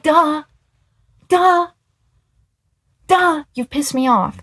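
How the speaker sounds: high, exasperated retort, lightly hammed up; mock-irritated duh. Retelling her reply to his band-name complaint, exasperated. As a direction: throw it back as an obvious, annoyed comeback.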